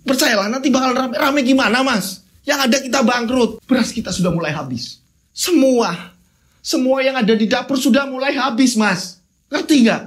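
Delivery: a wife nagging her husband; lightly voiced wife complaining about spoiled food. Wife scolds about going bankrupt.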